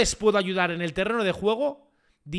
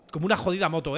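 usual speaking voice

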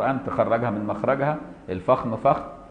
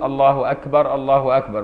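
mildly raised adhan call of Allahu Akbar; loud call; 2 semitones higher, 4 dB louder than usual. Shown as a demonstration. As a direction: call it out a bit louder. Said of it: "بصوت عالي". (نداء بصوت أعلى قليلا)

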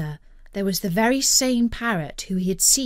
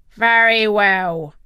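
pirate captain; 7 dB louder, slower than usual. A narrator's reading. Captain resumes dictation.

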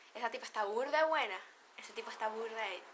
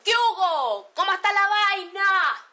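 loud, high shouted hello between men; shouted greeting; 8 semitones higher, 16 dB louder, slower than usual. Retold or imitated. Venezuelan men greeting each other with a shout. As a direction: bellow the greeting across the street, high and slow. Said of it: "Hombres se saludan gritando".

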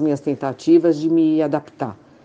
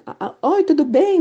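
much higher, sugary fake-cheerful hello; mock sweet greeting; 10 semitones higher than usual. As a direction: mimic a syrupy WhatsApp greeting. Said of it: "Vem aquelas mensagenzinhas". (voz aguda e melosa de mensagem falsa)

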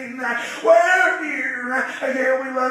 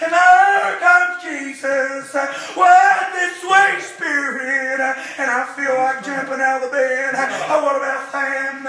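preaching; 6 dB louder than usual. Acted. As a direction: preach it out to the congregation with rising fire. Preacher chanting to congregation.

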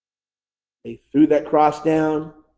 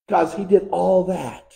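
ordinary talk, nothing marked

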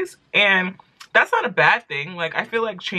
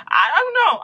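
exasperated high aside; 11 semitones higher than usual. Real, not acted. Exasperated aside about alcohol.

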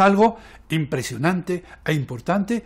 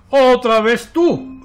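high, loud, drawn-out outburst; angry shout; 6 semitones higher, 8 dB louder, slower than usual. A narrator's reading. Square seeing the blot come back, shouting. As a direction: shout it in exasperation. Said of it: "grita Cuadrado".

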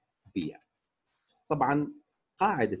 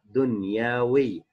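دنياوي slowly, long vowels held; slow classroom model of دنياوي; syllable-by-syllable demonstration; 4 dB louder, much slower than usual. Shown as a demonstration. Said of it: "بنفس الطريقة".

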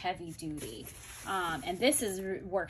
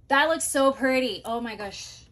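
much higher and louder with delight; thrilled; 5 semitones higher, 10 dB louder than usual. Real, not acted. Showing viewers her styled planner, loud delighted gush. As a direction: gush over how pretty it is, loudly.

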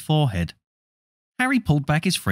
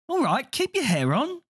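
put-on young boy voice; 3 dB quieter than usual. Twin answers mother.

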